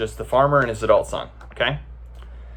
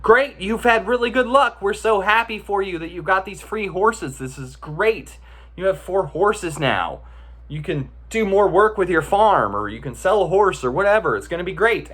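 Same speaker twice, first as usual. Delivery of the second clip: higher, eager neighbor voice, gushing congratulations; 8 semitones higher than usual. Acted. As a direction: play the neighbors cheering his luck.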